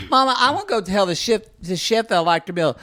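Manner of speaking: put-on a touch of a pleading child; child asking mother. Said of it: "if I said to my mother".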